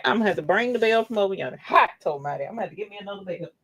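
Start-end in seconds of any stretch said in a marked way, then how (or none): none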